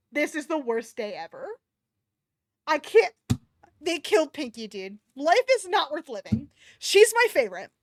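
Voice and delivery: loud, high wail of grief; mock despair. Real, not acted. Reader loudly mourning a favorite character. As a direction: wail over a lost favorite.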